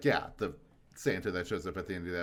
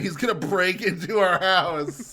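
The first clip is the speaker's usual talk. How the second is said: panicked Chucky; 6 semitones higher, 8 dB louder than usual. Retold or imitated. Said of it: "Chucky being like".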